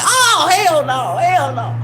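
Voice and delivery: slow, high, drawn-out oh hell no; he sees her throwing up and recoils, high and drawn out; horrified refusal. Retold or imitated. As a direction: recoil in disgust. She starts vomiting.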